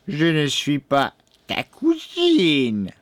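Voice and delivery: slow witch voice rising high on cousine; growling crone. A narrator's reading. Old woman snubs the boy, drawn-out grumbling crone voice. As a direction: stretch it out crossly as the old witch. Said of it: "grogna la boiteuse".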